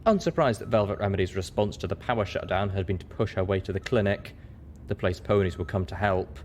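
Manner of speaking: ordinary delivery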